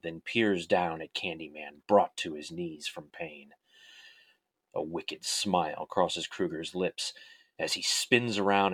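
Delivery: dramatic horror storytelling, measured pace; story narration. A narrator's reading. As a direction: read it like a dark tale.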